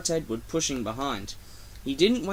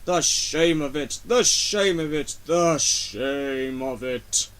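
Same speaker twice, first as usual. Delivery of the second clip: self-pitying; slower than usual. A narrator's reading. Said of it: "he grumbled".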